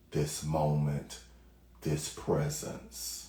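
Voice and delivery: soft, low meditation voice with long pauses; hushed, unhurried guidance, phrase by phrase; guided meditation. Acted. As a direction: gently ease listeners into stillness.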